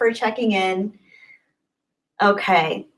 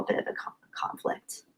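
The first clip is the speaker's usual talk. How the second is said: whisper; 14 dB quieter than usual. Real, not acted. Trails into a whisper.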